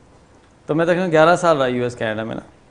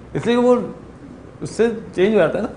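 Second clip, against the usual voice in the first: normal talking voice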